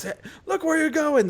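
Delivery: high mock shout of a cross-eyed orc. The orc shouts at the troll who bumped him, high mock yell. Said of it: "the orc shouts".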